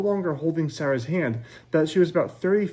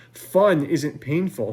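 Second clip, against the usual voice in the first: slow pointed retort; 4 dB louder, much slower than usual. Acted. Scolding argument.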